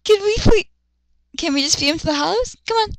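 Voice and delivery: ordinary delivery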